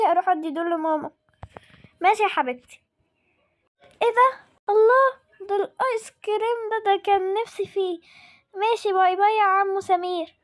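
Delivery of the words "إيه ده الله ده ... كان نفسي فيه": delighted surprise. Acted. Girl seeing the ice cream she wanted, high delighted surprise. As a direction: squeal like a kid spotting ice cream.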